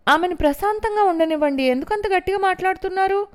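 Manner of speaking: high, loud, fast rebuke; stern warning. A narrator's reading. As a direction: warn her off sharply. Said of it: "అనూషను హెచ్చరించేసరికి".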